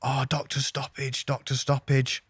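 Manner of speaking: a hint of an injured fighter pleading; imitating Conor. Conor tells the ref as Dustin wins, a lightly voiced plea. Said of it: "And he's telling Herb".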